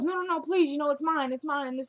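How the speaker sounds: high, pleading "no, no, no, please". Retold or imitated. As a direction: beg her not to take it. Anna begs to keep belongings.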